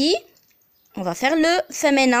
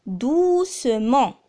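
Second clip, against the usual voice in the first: drawn out, each syllable clear; slow demo; slower than usual. Shown as a demonstration. Teacher to students, slow model word. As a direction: spell it out slowly for learners. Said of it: "Alors l'adverbe".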